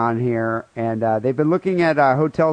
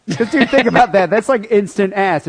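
excited; 6 semitones higher, 4 dB louder than usual. Real, not acted. Excited bar pitch.

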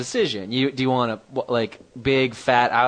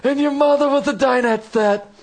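high-pitched mock trash talk; mock insult comic; 10 semitones higher than usual. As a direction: squeal hammy yo-mama jokes. Mock yo-mama insults in put-on voice.

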